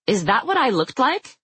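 high, disgusted question. Acted. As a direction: recoil in disgust. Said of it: "Nim asked in disgust".

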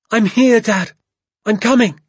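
high, loud eager shout; calling back. A narrator's reading. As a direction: answer a distant father as you run to him. Boy calls back to father.

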